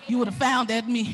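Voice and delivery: ordinary delivery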